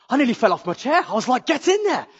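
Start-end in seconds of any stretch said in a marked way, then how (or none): 1.5-2.1 excited. Retold or imitated. Thrilled at friend attending church.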